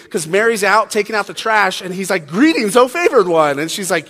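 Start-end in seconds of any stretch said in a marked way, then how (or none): 2.3-3.6 higher, louder heavenly proclamation; angel-y greeting. Acted. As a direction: hail them like an otherworldly angel. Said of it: "I think he seemed angel-y".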